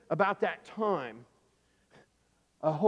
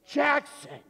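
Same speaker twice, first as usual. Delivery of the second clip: high mock-horrified cry; shocked exclamation; 9 semitones higher, 3 dB louder than usual. Storyteller exclaims at boy who killed duck, shocked.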